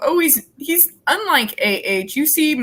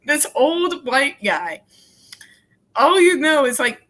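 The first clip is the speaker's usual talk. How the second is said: normal talking voice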